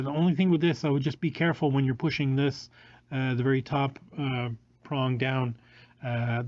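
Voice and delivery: usual speaking voice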